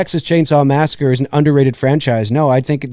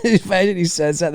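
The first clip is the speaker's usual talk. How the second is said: ordinary talk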